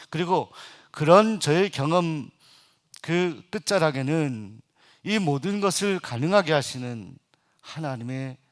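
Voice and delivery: normal talking voice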